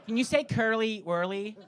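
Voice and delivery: mock American accent on "curly whirly"; eager American requesting another trick; Tyler. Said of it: "it's Americans asking me".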